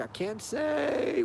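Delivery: lightly voiced cagey cop; hushed evasive officer. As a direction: hush it and drag it out, giving nothing away. Imitating Hendrix dodging the question.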